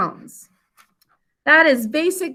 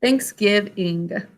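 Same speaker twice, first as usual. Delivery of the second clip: slow dictation; 4 semitones lower, 4 dB quieter, slower than usual. Shown as a demonstration. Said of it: "write Thanksgiving".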